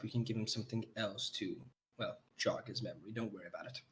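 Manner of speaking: hushed, soft aside; quietly. Acted. Innkeeper quietly reassures Severin about the sleeping drug. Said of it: "she goes to you sort of silently".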